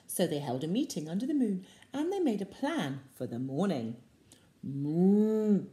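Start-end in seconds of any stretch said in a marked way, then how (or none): 4.6-5.7 loud moo; cow moo. A narrator's reading. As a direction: animals plotting together. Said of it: "said the duck".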